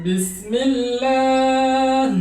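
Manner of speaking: বিসমিল্লাহ chanted slowly with a light, thin lam. Shown as a demonstration. Quran teacher chanting বিসমিল্লাহ slowly with a thin lam. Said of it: "যেমন".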